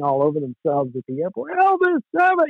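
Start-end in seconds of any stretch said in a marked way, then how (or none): 1.5-2.5 excited fans calling. Retold or imitated. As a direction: call his name like a thrilled fan, pitched high.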